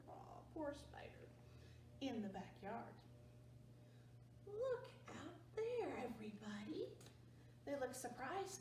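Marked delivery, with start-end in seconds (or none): 0.1-1.3 sympathetic aw. Real, not acted. Sympathy aside. 4.5-6.9 storyteller whisper. Real, not acted. Whispers to kids.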